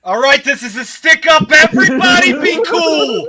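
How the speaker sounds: sudden loud robber voice; stick-up shout. Acted. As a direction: burst in like a bank robber. Bank robbery burst after whispers.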